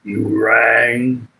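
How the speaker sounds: a hint of the Addams Family butler greeting; Lurch impression. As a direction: try for the butler's deep "You rang". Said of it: "the Lurch character was really cool".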